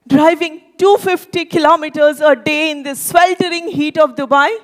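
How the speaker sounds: normal talking voice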